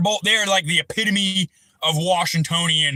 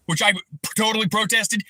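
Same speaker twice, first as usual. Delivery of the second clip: ordinary talk, nothing marked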